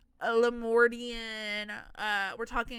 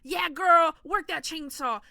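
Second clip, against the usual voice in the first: mock hype; 5 semitones higher, 8 dB louder, slower than usual. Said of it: "And I was like".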